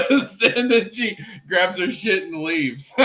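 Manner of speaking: laughing through the words; can't stop giggling while telling it. Real, not acted. Friend retelling the Jesus scene, laughing.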